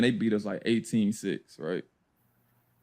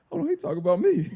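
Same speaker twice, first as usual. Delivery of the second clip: retold disbelief; 12 semitones higher, faster than usual. Teller's retold dawning disbelief.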